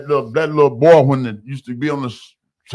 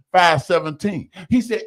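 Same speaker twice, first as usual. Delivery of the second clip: usual speaking voice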